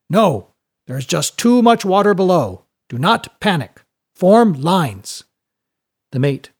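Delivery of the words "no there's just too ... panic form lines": raised, higher commanding voice; firm public order. Acted. Mate answering frightened passengers, loud firm command. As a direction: take charge and quell a panic.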